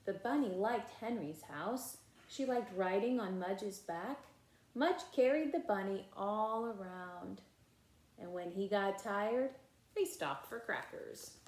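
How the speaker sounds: put-on lively read-aloud voice for children; animated storybook reading with character lines; story narration. A narrator's reading. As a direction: read it like a bedtime picture book. Reading picture book aloud.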